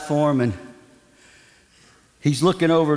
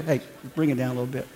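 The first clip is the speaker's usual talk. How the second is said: fast, soft mock whisper, half laughing; leaning over to quietly ask the singer to tone it down; hushed aside; 6 semitones lower, 6 dB quieter, much faster than usual. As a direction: mutter it quickly with a laugh. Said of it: "He leans".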